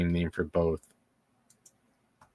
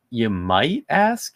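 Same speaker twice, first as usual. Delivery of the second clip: normal talking voice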